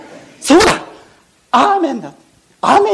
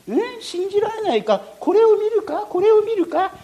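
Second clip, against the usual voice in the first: put-on teasing old man; 9 semitones higher, 4 dB quieter than usual. Old man challenges doubters.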